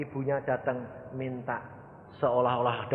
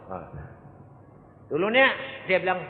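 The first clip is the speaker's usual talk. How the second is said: usual speaking voice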